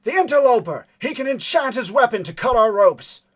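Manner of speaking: high, full-force villain's shout; yell across a clearing. Acted. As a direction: shout the alarm to distant allies. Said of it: "yells from across the clearing".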